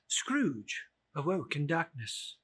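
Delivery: ordinary delivery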